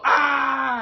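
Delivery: huge high full-throated battle yell; wild scream. Retold or imitated. Tarzan putting his wig back on, wild triumphant scream. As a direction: scream as loud as you can. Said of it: "I just scream and go".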